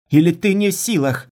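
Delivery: loud, high-pitched jeer; angry taunt. Acted. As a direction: goad him with a loud challenge. Girl taunts her lover.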